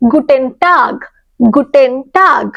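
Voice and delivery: গুটেন টাগ said slowly, টাগ with an open a and a clear ending; careful slow German guten Tag for learners; slow German model. Shown as a demonstration. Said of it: "তাহলে তুমি কি বলতে পারো good day কে?".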